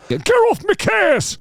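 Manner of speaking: high, drawn-out Mancunian yell. Retold or imitated. Retrying the singer's retort, loud high Manchester accent. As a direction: squawk it loudly in a mock Manchester accent. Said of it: "I'll try again".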